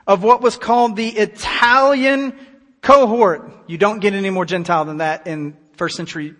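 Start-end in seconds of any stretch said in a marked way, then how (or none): 0.1-3.5 "Italian" and "cohort" slow, loud and raised in pitch; emphatic reading. Shown as a demonstration. Preacher makes the congregation hear how Gentile he is, "Italian cohort" stressed hard. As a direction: punch "Italian cohort" word by word. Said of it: "Listen to this very closely".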